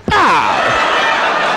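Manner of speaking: a short, loud "Ah!" with a laugh in it; laughing yelp. Acted. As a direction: blurt out a laughing cry. Outburst ending a quoted quarrel.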